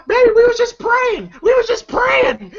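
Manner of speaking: high-pitched mock pleading; pleading excuse. Caught cheater excusing himself to the husband, high mock pleading. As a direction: plead your innocence in a high, hammed-up voice.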